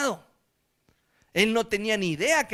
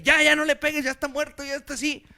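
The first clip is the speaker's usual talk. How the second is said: referee yelling; 7 semitones higher, 5 dB louder than usual. Retold or imitated. Referee stopping the fight.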